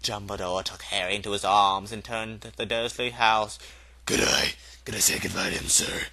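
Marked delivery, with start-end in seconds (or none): 4.1-6.1 low, humble giant's voice; a lightly voiced big giant asking softly; gentle giant. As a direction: Hagrid begs to say goodbye to baby Harry, low and humble. Hagrid asks to say goodbye.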